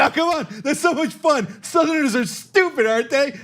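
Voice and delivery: a hint of a giggling fan excusing Trump; mocking Trump fans. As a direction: play a delighted fan, half-hearted parody. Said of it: "But when Trump does it".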